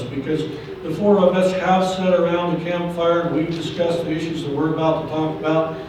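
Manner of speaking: ordinary talk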